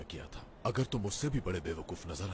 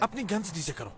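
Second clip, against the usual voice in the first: commanding; 12 semitones higher, 5 dB louder than usual. Acted. Guns drawn, calling for calm.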